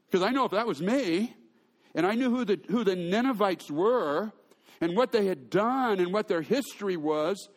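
ordinary delivery

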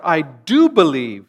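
mild cry, slow with punched DO. Retold or imitated. Desperate father crying out to Jesus, mild outcry. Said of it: "the boy's father cried out".

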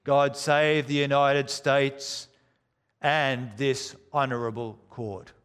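American court marshal calling the court to order; mock American marshal's cry, oyez; American accent. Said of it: "with an American accent".

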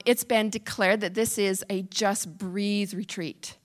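usual speaking voice

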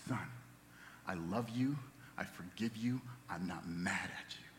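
put-on hushed, tender reassurance to a child. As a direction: soften right down to a near whisper, forgiving. Tender words to crying son.

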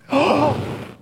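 sharp, high acted gasp; audible gasp. Crowd watching Jesus reach for the leper, shocked gasp. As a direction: suck in breath in mock shock. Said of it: "there were probably audible gasps".

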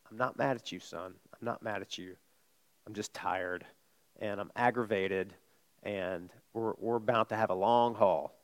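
low, subdued voice to your son; retold weary, restrained dad; quietly mad. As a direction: hold the anger in, speak softly and low. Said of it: "I get really quiet".

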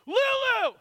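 put-on full high shout of a child's name; sudden, very loud, high-pitched holler; mock-calm yell. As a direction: yell after a runaway daughter. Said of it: "very calmly say".

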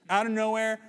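usual speaking voice

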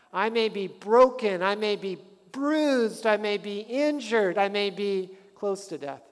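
loud, rising sermon cadence; a pastor driving the point home; fiery preaching. Acted. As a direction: preach it with fire. Preaching at full force.

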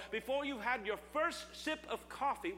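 usual speaking voice

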